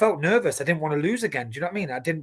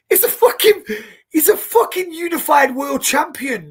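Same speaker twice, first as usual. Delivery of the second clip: high, loud incredulous outburst; indignant retort; 13 semitones higher, 8 dB louder than usual. Retold or imitated. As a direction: protest in disbelief, pitch leaping way up. Indignant retort to an online comment.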